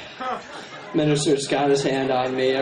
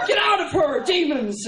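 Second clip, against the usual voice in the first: exorcist command; 11 semitones higher than usual. Retold or imitated. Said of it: "they're like".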